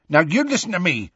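quick, high-pitched angry warning. A narrator's reading. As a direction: cut in angrily. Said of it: "said Hagrid angrily".

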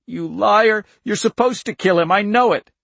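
high, loud, accusing outburst; angry accusation. A narrator's reading. Girl accuses agent of plotting to kill father, angry.

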